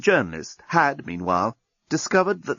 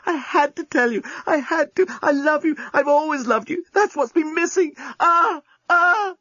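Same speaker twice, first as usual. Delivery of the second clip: much higher, breathless frantic pleading; Lucy frantic; 13 semitones higher than usual. Acted. Lucy to the journalist with minutes left, high frantic and passionate. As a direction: gush it all out in a panic of passion. Lucy's breathless love confession.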